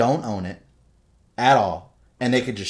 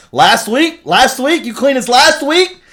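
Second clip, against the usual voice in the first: much higher, louder disbelieving shout; incredulous mock yell; 14 dB louder, slower than usual. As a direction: demand the truth in outraged disbelief. Host angry at lying owner.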